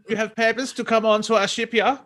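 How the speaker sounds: put-on high, hammy German accent. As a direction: quiz him like a German guard. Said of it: "In a German accent".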